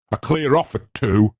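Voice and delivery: high-pitched housekeeper's announcement; curt housekeeper announcing. Acted. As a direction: announce the hour from the doorway. Mrs Dudley announces from the doorway.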